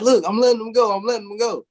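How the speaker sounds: put-on loud pleading child voice; mock pleading shout. Kid calls out to mom that he's letting them go, pleading loudly. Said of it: "I'm like".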